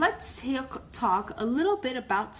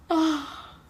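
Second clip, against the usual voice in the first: yawn; 7 semitones higher, 5 dB louder than usual. Acted. Yawning squirrel.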